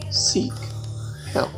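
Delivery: ordinary delivery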